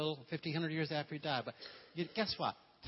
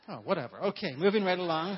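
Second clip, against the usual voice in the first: breezy dismissal; 4 semitones higher, 9 dB louder than usual. Real, not acted. Brushing off own remark.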